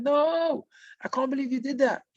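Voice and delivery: high, wailing cry of a condemned spirit; retold anguished sob, pitched high. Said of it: "He cried and he cried and he screamed".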